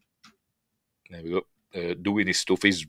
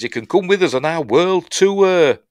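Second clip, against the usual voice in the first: a higher, louder woman's voice, lightly voiced; T J's eager invitation; 8 semitones higher, 6 dB louder than usual. T J pitching the world tour to him, higher and louder. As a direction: she gushes about the world tour.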